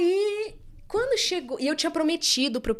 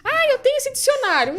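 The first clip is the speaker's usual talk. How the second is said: much higher, louder mock boy voice; imitating the eager boy; 9 dB louder than usual. Boy offering her milk, high loud mock voice.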